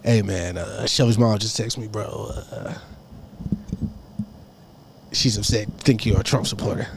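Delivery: low, soft hesitant message; subdued, low imitation of a texting friend; imitated friend. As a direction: relay it quietly and low. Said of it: "he's like".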